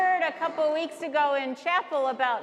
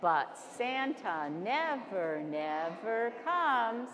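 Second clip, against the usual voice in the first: soft, slow poem reading; poem recitation; 6 semitones lower, 5 dB quieter than usual. Acted. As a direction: recite it softly and slowly. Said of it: "say this poem with me".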